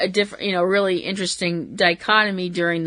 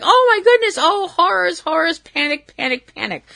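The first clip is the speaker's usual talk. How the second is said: high, mock-panicked announcer voice fading down; mock horror; 9 semitones higher than usual. Mocking the announcers' fake panic, high then fading. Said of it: "the announcers are like".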